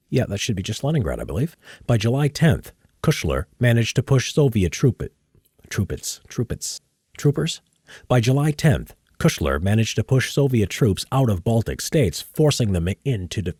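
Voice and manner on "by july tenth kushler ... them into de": measured history narrator read; documentary narration. Acted. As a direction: read it like a war documentary voice-over.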